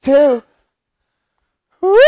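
loud pumped-up shout; hype shout. Real, not acted. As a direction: cheer yourself on mid-set. Hype during exercise.

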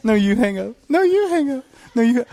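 put-on high, giggly lovesick teen; giggly late night call. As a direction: coo like a teen on the phone. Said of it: "stayed up way too late on the phone".

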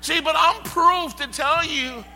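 shouted revival preaching; full-force preaching. Acted. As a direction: preach it hard to the congregation.

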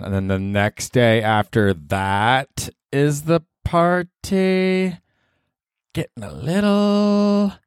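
high, slow, drawn-out whine; weary partier. Pretend. Podcaster hamming up holiday burnout, high and slow. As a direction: stretch it out like a tired reveler. Mock weary post-party voice.

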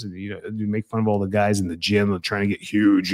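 normal talking voice

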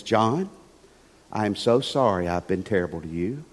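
a low, soft, contrite parrot voice; repentant parrot. Retold or imitated. Preacher's joke, the frozen parrot begging John's pardon, low and sorry.

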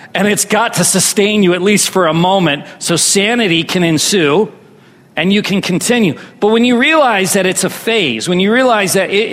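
normal talking voice

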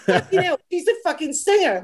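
high-pitched retold disbelief; amazed exclamation at normal volume; astonished. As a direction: imitate a friend's stunned realization.

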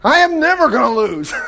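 high, hammed-up boast; mock boasting. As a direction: crow it like a sure winner. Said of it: "David's like".

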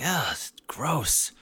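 high whispered disgust; whispered brother quote. Retold or imitated. Brother whispering disgust at the steak. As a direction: whisper it, grossed out.

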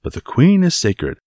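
emphatic tirade with swinging pitch; blustering Porthos, reproachful. Acted. Porthos lectures Aramis about respecting the queen, reproachful. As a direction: scold with theatrical exasperation.

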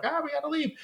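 high squeaky mock alarm; hammed-up high panic. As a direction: yelp it like scared kids. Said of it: "they're like".

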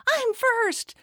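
put-on high toddler voice announcing it; proud toddler. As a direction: squeak it out like a proud little kid. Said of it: "and he says".

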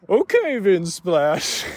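slow, drawn-out put-on catchphrase; imitating an underwhelmed group response; mock let-down. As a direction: draw it out as a comic reaction to a letdown. Re-enacts disappointed catchphrase to level maker.

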